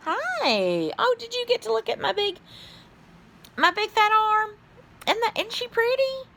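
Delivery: very high cutesy voice; squeaky-high playful show-off; cutesy cooing. Pretend. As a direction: coo it high, showing off something precious. Cooing while showing something off.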